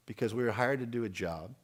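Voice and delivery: ordinary talk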